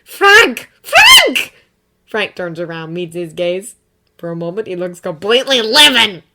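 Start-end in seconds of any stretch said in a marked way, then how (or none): 0.0-1.5 high, loud urgent calls; wife calling her husband in shock; excited shouting. A narrator's reading. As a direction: shout his name to get his attention. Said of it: "She gasps like she's seen a ghost".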